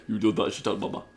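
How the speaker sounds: imitating a deep African-accented troll; mock-menacing African accent. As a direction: threaten solemnly in an African accent. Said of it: "in a really deep, very African voice".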